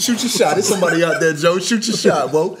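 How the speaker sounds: high, laughing hype. Real, not acted. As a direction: cheer him on through laughs. Laughing encouragement.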